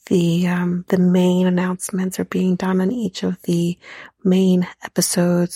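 ordinary talk

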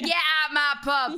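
slow, loud London landlady bellow; EastEnders accent. Shown as a demonstration. Soap landlady throwing someone out, EastEnders accent. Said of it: "that's more EastEnders".

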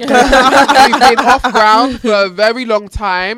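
slow, loud, drawn-out whine; a put-upon passenger pleading to land; mock-dramatic complaint. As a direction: ham up being stuck in the air forever.

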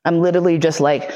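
ordinary delivery

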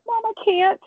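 pushing the words out through real tears; close to tears. Real, not acted. Telling her mother she can't leave, voice breaking with tears. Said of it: "Don't make me cry".

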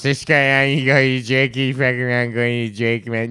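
a hint of his friend Los; imitating Los. Mocking his buddy [name] for skipping the [name], mildly put-on. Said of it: "I do it for fucking Los".

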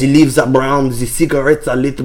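seduce in a fake French accent. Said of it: "your impression of a Frenchman".

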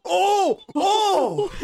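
high, loud cry of shock. Real, not acted. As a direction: yelp out a shocked oh. Shocked at the blow.

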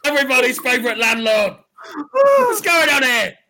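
blustering landlord. Acted. Landlord confronting troublemakers in his pub, blustering loudly. Landlord announcing himself, calling out.

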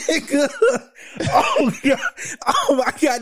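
high wheezy laughing words; laughing hard. Real, not acted. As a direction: squeak it through laughter. Still laughing at the quote.

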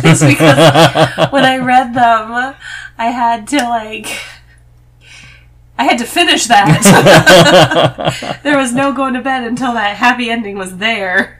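laughing loudly through the words. Real, not acted. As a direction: laugh while you explain.

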